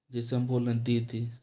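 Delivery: ordinary talk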